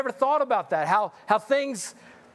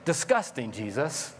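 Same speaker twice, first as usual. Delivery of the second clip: grossed-out aside; 8 semitones lower, 4 dB quieter than usual. Pretend. Disgusted aside to Jesus.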